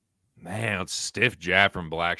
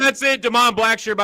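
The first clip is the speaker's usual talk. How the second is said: high, loud finish call; excited call; 5 dB louder than usual. Real, not acted. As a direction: announce the submission win. Calling the tap-out.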